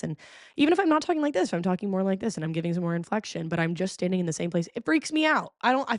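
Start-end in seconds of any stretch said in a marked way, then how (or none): 0.6-1.5 animated demo. Shown as a demonstration.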